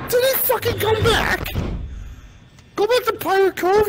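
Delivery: high-pitched panicked cry; panicked high yelling. Real, not acted. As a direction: shriek it in fright. Panicked at animatronic return.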